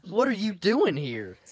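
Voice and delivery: loud, high mock outrage at the newcomer; mock indignant shout. Imagined churchgoer loudly confronting the man in the yarmulke, mock outraged shout. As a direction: bellow it like an offended churchgoer.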